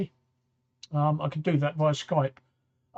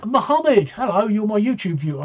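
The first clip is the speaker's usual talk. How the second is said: excited greeting; 4 semitones higher, 6 dB louder than usual. Real, not acted. Spots a friend joining the stream.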